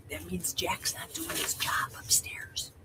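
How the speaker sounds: whispered aside; hushed unvoiced whisper. Real, not acted.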